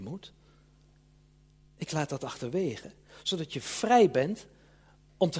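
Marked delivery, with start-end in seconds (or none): 0.0-0.3 Moet barely breathed, much softer; dropped must. Shown as a demonstration. Preacher showing he no longer says it, fading softly. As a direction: let the word Moet fall away quietly. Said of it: "dus ik zeg niet meer".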